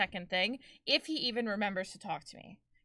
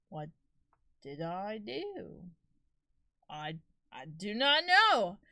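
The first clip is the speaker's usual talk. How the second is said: very slow, stretched-out puzzled question and answer; drawn-out bafflement; much slower than usual. Retold or imitated. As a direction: drag each word out, mock-baffled. Acts out baffled reaction to being blocked.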